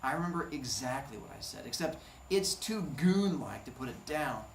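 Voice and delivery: usual speaking voice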